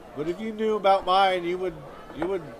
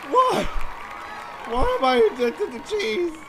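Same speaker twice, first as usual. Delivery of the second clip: very high, loud mock cry to heaven; anguished wail; 9 semitones higher, 3 dB louder, slower than usual. On his knees crying out to God, hammed-up wail. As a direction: rail at God, overdone. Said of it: "And I was like".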